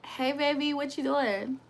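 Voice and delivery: soft, sweet check-in; tender phone voice. Real, not acted. Woman checking in with her man by phone, tender. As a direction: speak softly to your sweetheart.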